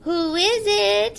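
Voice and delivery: high, slow, sugary fake granny; the wolf posing as sweet granny; sweetest Granny voice. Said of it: "in his sweetest Granny voice".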